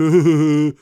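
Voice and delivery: loud, high mock laughter; imitated kid's laugh. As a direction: mimic the kid cackling on camera. Imitating the kid on the video.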